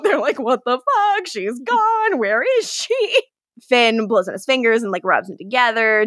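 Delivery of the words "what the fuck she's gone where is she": mock panic. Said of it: "the boys are freaking out".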